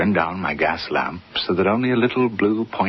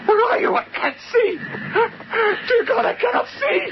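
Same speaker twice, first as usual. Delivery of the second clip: screaming in terror. Acted. Harry blind in the dark, shrieking and sobbing. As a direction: scream it out, half crying.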